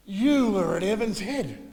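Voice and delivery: high, loud and slow, insisting; loud delighted accusation; eager recognition. Retold or imitated. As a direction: slowly spell out where you know her from. Woman recognising Mum, retold.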